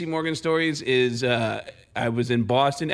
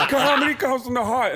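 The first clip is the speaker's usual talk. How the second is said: much higher, laughing put-on voice; Tracy Morgan impression again; 10 semitones higher than usual. Comic repeating Tracy Morgan's line to the hosts.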